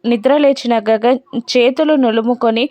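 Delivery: plain reading voice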